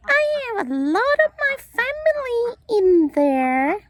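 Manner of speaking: high, chirpy little bird voice throughout; chirpy pet bird voice. Acted. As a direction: play the bird proudly introducing itself. Bird character voiced by owner.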